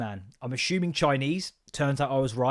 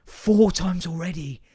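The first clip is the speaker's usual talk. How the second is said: mock exasperated rant; 5 semitones higher, 5 dB louder than usual. Ranting at bored pupils.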